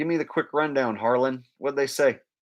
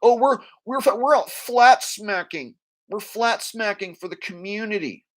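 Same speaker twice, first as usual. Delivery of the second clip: higher, put-on boastful voice; mocking self-important activists; 8 semitones higher, 4 dB louder than usual. Activists proud of flat smacking for the community, mocking voice. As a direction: mimic their pride in a raised silly voice. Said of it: "they consider themselves".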